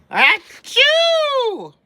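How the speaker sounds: loud, high big sneeze. Acted. Acted bear sneeze.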